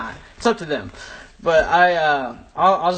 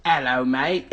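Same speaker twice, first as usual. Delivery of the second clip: put-on extreme British accent. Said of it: "an extreme British version".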